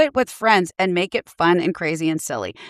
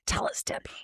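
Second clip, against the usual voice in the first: whisper; 12 dB quieter than usual. Acted.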